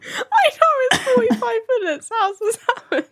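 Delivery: very high, whiny fake wail; mock crying.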